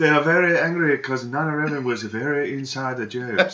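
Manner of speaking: high, foreign-sounding broken English. Acted. Mock accent joke.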